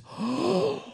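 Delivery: big high-pitched gasp; imitated child's gasp. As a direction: gasp like an awestruck kid. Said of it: "She goes".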